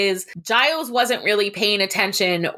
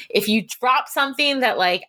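usual speaking voice

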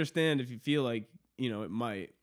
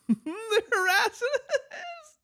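laughing; 5 dB louder, slower than usual. Real, not acted. Cracks up mid-quote.

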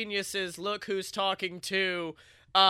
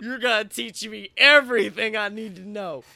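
Nicolas Cage; 6 dB louder than usual. Acted. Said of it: "Nick was like".

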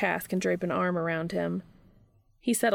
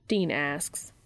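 ordinary delivery, nothing marked

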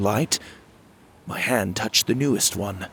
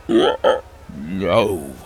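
pathetic croak; 2 semitones lower, 4 dB louder than usual. Acted. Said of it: "Timothy croaked pathetically".

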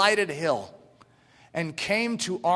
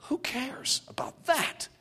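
soft, slower whisper; whispered aside; 7 semitones higher, 6 dB quieter, slower than usual. Acted. Preacher dismissing worldly things to the congregation, whispered. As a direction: lean in and whisper it.

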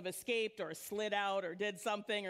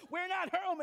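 mock hiding homeowner; 7 semitones higher, 5 dB louder than usual. Mimicking people hiding from visitors.

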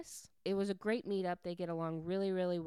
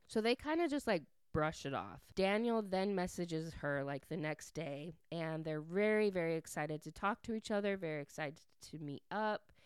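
ordinary delivery

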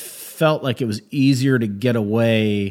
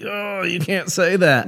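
higher, scandalized mock protest; mock shocked objection; 8 semitones higher than usual. Comic retelling liberal people's reaction to an abrasive bit, mock shocked. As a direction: imitate an audience member aghast.

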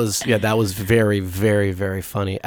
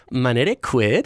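put-on light valley-girl question lilt on Quid; slower than usual. Shown as a demonstration. Host showing how the brand name is said, valley girl. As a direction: Latin with a valley-girl rise at the end. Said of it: "you have to say that".

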